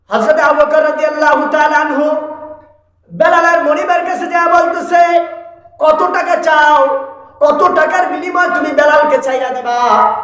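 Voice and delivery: high, drawn-out sermon declamation; impassioned preaching. Real, not acted. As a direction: stretch the phrases in a high preaching cry. Preaches at full force. (উঁচু সুরে টেনে টেনে ওয়াজ)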